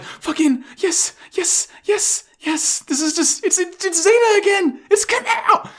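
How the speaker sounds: very high squealing fan glee; freaking out. Retold or imitated. Fan recognising a beloved character returning, freaking out. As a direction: lose it with ecstatic high-pitched excitement. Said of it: "I'm freaking out".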